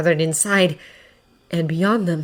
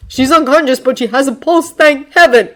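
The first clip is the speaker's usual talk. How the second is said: raised, higher voice; calling out; 7 semitones higher, 7 dB louder than usual. A narrator's reading. Tiger reports back to Mount Lady, calling out. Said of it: "calling to each other from within".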